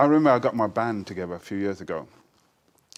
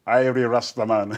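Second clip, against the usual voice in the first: a louder, hammed-up Scot doing Jamaican patois; Jamaican Scottish accent; 2 semitones higher, 8 dB louder than usual. Scottish reggae fan greets the poet at Edinburgh, mock Jamaican-Scottish accent. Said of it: "this Jamaican Scottish thing".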